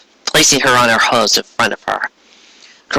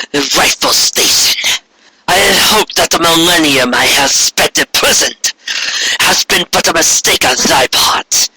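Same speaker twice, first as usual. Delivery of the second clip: higher, stately voice of a proud queen; Corona, regal and resentful; 5 semitones higher than usual. Acted. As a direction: look down on a treacherous sister. Corona speaks to captive Luna.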